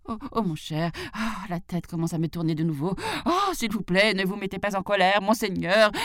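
hurried pleading, rising in pitch; flustered jackal. Pretend. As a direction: build from dizzy moan to panicky begging. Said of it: "faisant semblant de trembler de peur". (chacal étourdi puis suppliant)

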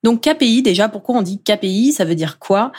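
ordinary talk, nothing marked

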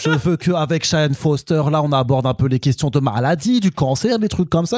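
fan's rant in a fake Alsatian accent; mock Alsatian accent, sliding from Quebec; botched Alsatian accent. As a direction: grumble about the film in hammy Alsatian French. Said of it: "ça part en accent alsacien".

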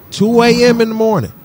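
outraged emphasis. Real, not acted. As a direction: hit it with disbelief.

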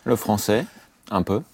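usual speaking voice

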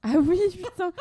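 exclamation haute et forte de surprise; ça te revient d'un coup, avec éclat; soudain souvenir. Real, not acted. Se souvient d'André dans le film. (Ah oui, surpris et amusé)

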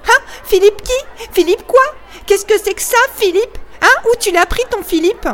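mock him like a gang of kids; children taunting Simon about his papa; jeering schoolboys. A narrator's reading. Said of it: "Des hurlements de joie jaillirent".

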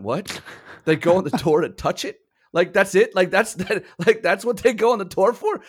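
high, rising incredulous questions; acted disbelief. Retells astonished reaction to guide.

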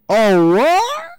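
sudden high, loud "oh, what?"; stunned outburst; shocked. Retold or imitated. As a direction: caught off guard, blurting it out. Catching the two dates together.

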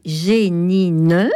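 Génine with a sounded final E, its last syllable rising; over-accented French pronunciation. Shown as a demonstration. Explaining old French spelling, Génine with an over-accented final E. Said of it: "la prononciation française, euh, un peu trop accentuée".

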